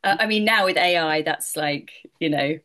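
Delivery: normal talking voice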